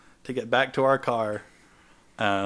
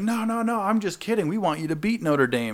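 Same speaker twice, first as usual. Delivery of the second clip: much higher mock apology; backpedalling; 10 semitones higher, 4 dB louder than usual. Brother backing down after flipping off fan, high mock apology. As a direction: squeak out a fake sorry.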